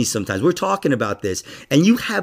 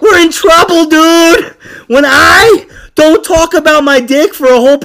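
loud, high-pitched mock alarm; comic outburst; 13 semitones higher, 8 dB louder than usual. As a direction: yell it in exaggerated panic.

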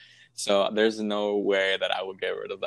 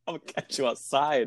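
sassy threat; 3 semitones higher than usual. Retold or imitated. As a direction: snap back with a sassy threat, laughing at the end. Voicing her inner reply to customer.

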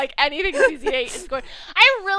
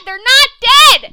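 slow, loud, high insistence; insistent; 10 semitones higher, 12 dB louder, much slower than usual. Real, not acted. Friend insisting to fans they're not dead, slow and loud. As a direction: hammer each word home.

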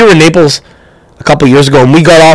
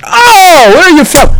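high, eager Neapolitan cab driver; a high chatty Neapolitan taxi driver; 13 semitones higher, slower than usual. Retold or imitated. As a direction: play the chatty driver perking up. Retold cab driver questions.

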